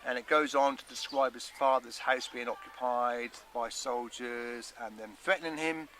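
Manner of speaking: ordinary delivery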